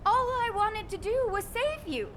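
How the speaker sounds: high-pitched, emotional outpouring; anguished reproach. Acted. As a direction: plead and accuse, deeply hurt. Kate's anguished quarrel with Freyna.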